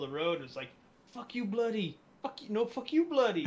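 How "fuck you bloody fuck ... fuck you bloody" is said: mock Indian quarrel. Retelling a road argument video to friends, mock Indian accent. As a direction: ham up two Indian guys yelling fuck you, bloody. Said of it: "two Indian guys are arguing".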